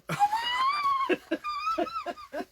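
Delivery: real helpless shriek of laughter. Real, not acted. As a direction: lose it completely in a shrieking laugh. Dog pooing in shot.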